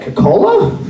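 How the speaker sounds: tentative question leaping up high; high-pitched query. Retold or imitated. Teacher checking what the child meant by Coke, high-pitched query. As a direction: ask it with a steep rising squeak.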